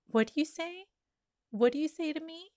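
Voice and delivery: ordinary delivery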